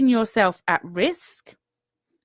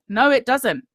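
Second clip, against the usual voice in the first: ordinary talk, nothing marked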